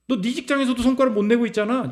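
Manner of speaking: mock the failure in a sing-song; mocking sneer. Preacher voicing scoffers who sneer at a failed side business. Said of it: "사람들이 비웃는다는 거예요".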